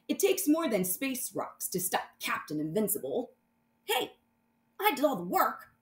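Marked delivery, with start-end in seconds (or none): 3.8-5.7 high indignant sidekick; dog sidekick voice. Acted. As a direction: complain like a cartoon dog. Dog Comet protests.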